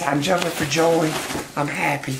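high, giddy little monkey voice. Retold or imitated. Owner voicing her jumping pet monkey, high and giddy.

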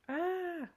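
high, loud scream; startled scream. Acted. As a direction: shriek at the sight of a bear. Another customer screams.

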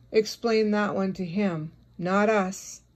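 normal talking voice

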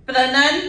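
"प्रनन" blended, pr with no vowel; joined prenun. Shown as a demonstration. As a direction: join the first syllables. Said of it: "थोड़ा थोड़ा करके join कीजिए".

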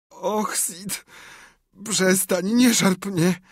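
high, drawn-out groaning plea; moaning. A narrator's reading. Tom fakes illness to his brother, high moaning. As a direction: moan as if in pain. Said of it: "jęknął wreszcie Tomek".

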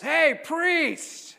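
very high, loud holler; imitated yell. Pub patron yelling at the collared priest, hollering. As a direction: shout it across a noisy pub. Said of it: "one guy yells out".

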